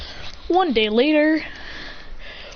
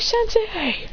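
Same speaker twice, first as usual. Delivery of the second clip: high mock call, held at normal volume; excited greeting; 12 semitones higher than usual. Students see Kakashi catching up, excited greeting. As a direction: greet a teacher in a raised pitch.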